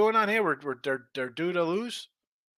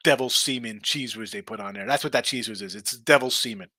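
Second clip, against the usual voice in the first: ordinary talk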